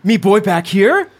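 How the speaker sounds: a lightly voiced broken-English father; calling for his son, simple and fond; caveman dad. Father Ron spots his son in the salon, lightly voiced caveman dad. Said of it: "Ron looks up and says".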